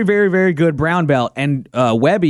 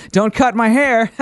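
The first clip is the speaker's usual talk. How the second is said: higher, nervous little quip with laughter; nervous joking; 5 semitones higher than usual. Retold or imitated. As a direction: imitate joking nervously before a fight. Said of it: "And then I nervously".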